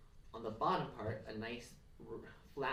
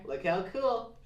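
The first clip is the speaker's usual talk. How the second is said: loud, high, delighted; excited; 6 semitones higher, 8 dB louder, slower than usual. Real, not acted. Craft teacher showing the attached antler, excited. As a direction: point it out with glee.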